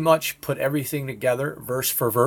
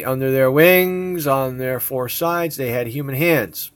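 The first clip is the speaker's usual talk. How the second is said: slow, louder solemn Bible reading; scripture reading; 3 semitones higher, 5 dB louder than usual. Acted. Showing viewers Ezekiel, he reads the verse slowly and solemnly. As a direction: read it like a preacher at the pulpit.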